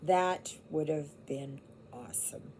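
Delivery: whispered wistfully to herself; soft whispered longing. Real, not acted. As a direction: breathe it out as a private wish. Repeated in whisper.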